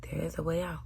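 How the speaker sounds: whispered reassurance; soft hushed whisper. Shown as a demonstration. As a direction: whisper the promise. Whispered repeat.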